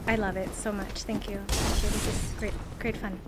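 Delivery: low, soft, defeated monotone; deadpan sarcasm. Real, not acted. Gamer thanking the game after dying, deadpan sarcasm. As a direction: feign gratitude in a drained murmur.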